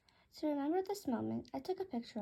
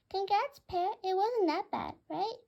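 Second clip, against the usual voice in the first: high, loud budgie voice; chirpy bird voice; 7 semitones higher, 7 dB louder than usual. Acted. Budgie Bluey congratulating Pear on stepping up, high loud bird voice. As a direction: squawk it out cheerfully.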